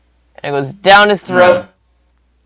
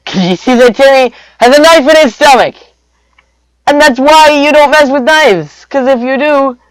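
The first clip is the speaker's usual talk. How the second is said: high, loud, worked-up narration; hammed-up hysterical warning; 10 semitones higher, 8 dB louder than usual. Mom's scream carries into warning.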